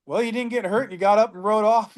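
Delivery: lightly voiced high jokey consolation; teasing reply. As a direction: needle him with a grin. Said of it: "I go".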